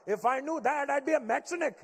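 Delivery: indignant Indian dad. Retold or imitated. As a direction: snap back like an offended Indian father. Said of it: "make everything sound Indian".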